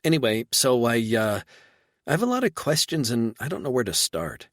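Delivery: woman overwhelmed by questions; light flustered female voice; lightly voiced woman. Reba flustered.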